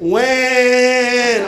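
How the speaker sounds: long, high imitated cry; hammed-up crying in all caps; mock baby wail. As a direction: wail one drawn-out note like a baby. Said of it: "tell you that he's crying, he types out".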